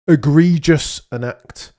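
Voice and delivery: mock grave. Mock-grave verdict on missing chats.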